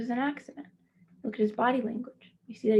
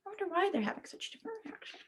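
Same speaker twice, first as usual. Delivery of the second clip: wondering; 7 semitones higher, 9 dB quieter, faster than usual. Real, not acted. Teacher wonders aloud to children.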